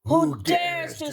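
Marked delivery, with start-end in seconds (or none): 0.0-0.2 high, echoing challenge in a doubled voice; imperious otherworldly child, drawing out the words; high, ringing, layered demand; spitting demand. A narrator's reading. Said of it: "spat the little gray-eyed girl". 0.4-1.0 high, echoing challenge in a doubled voice; imperious otherworldly child, drawing out the words; high, ringing, layered demand; spitting demand. A narrator's reading. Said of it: "spat the little gray-eyed girl".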